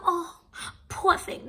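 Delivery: high, cooing fake sympathy; mock pity. Mimicking people who see her cry, mock pity. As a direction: imitate someone fussing over a crier.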